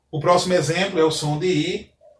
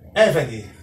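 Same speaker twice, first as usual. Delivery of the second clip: Eved with the first syllable stressed, falling; 3 semitones lower than usual. Shown as a demonstration. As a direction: pronounce Eved clearly as EH-ve-de, weight on EH. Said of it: "pois a sílaba tônica está no Ai". (Eved com a primeira sílaba tônica)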